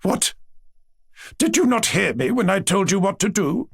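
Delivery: high-pitched indignant question; indignant demand. A narrator's reading. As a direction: demand an answer from a disobedient child. Grandmother demands obedience.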